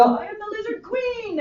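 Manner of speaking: high, breathy little Lizard Queen line; Lizard Queen quote. Retold or imitated. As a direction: quote it like a cartoon girl. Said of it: "I have quoted Simpsons before".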